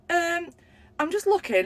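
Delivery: mock flustered shop assistant; stalling staff. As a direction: squeak it out caught out. Woman stalling on phone.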